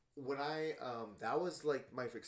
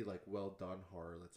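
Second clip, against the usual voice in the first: normal talking voice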